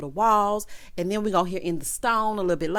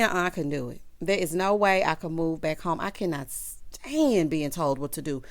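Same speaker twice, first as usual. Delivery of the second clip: ordinary delivery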